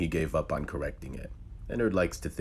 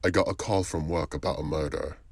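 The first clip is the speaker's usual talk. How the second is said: usual speaking voice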